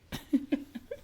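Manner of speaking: high laugh. Real, not acted. Laughing at his own joke.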